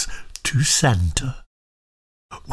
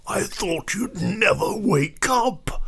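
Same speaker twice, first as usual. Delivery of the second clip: high, softer, strained character voice; Santa; 5 semitones higher, 5 dB quieter than usual. A narrator's reading. Santa greets his rescuers after the long wait, high soft complaint. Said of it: "he said".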